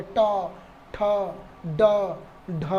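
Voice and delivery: ट, ठ, ड, ढ as separate syllables, tongue curled back; retroflex sounds demo. Shown as a demonstration. Teacher demonstrating retroflex ट ठ ड ढ for the class, one by one. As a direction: pause between each of ट ठ ड ढ. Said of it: "जैसे हम उच्चारण करके देख लें".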